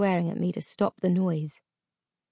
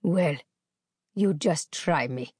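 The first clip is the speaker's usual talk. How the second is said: slow menace; slower than usual. A narrator's reading. Captor answering whether he'd kill her. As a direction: let the threat hang. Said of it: "he replied".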